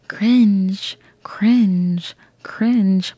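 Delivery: whispered self-mockery; mock-ashamed whisper. Real, not acted. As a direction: breathe it out, unvoiced. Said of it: "whispered self-mockery".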